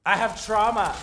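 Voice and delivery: mock dramatic. Real, not acted.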